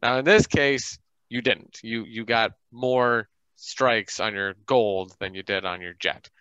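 usual speaking voice

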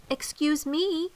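high, slow bird voice; little sparrow. A narrator's reading. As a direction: pipe up politely as a small bird. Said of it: "said the sparrow".

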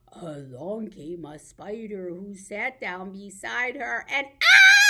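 playful scream; mock fright. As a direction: startle the kids with the spider. Nursery rhyme fright.

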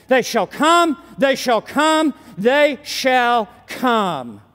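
repeated, the last one slow and drawn out; building emphasis. Acted. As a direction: let the final "they shall come" slow right down. Preacher repeats the promise, slowing.

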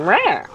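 little high "rah" cry; imitated creature squeak. Showing a friend the creature's noise, high imitated squeal. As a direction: squeal like a small cute creature. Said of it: "little sounds like".

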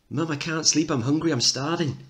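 put-on tired little kid complaining from bed; small child. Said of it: "Kid four off".

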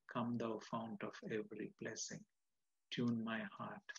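preacher reciting hymn lines quietly; soft reading. Acted. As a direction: read it hushed, like a prayer. Reads hymn lines softly.

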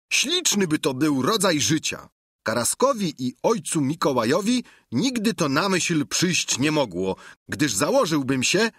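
a simple squire chattering cheerfully to his master; Sancho the squire. Acted.

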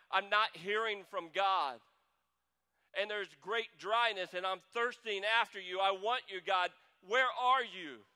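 desperate believer calling up to heaven; crying out to God. Acted. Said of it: "it's kinda like".

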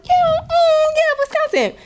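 very high, sing-song mock yes; mock agreement. She answers strangers in a sing-song mock voice, very high. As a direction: humor them with a squeaky yes.

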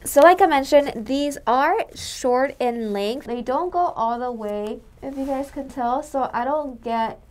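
muffled behind mask; speaking into respirator; through a face mask. Real, not acted. Seen 0: Cup-style respirator covers mouth and nose throughout the labelled words.

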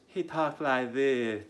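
slow, drawn-out affected lisp; mocking soft lisping voice. Host mocks Wilbur's way of talking, soft affected lisp. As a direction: mimic a purposely soft voice, dragging it out. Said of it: "he has this purposefully soft voice".

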